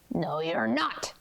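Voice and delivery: low, curt storybook troll; low troll refusal. A narrator's reading. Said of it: "said the troll".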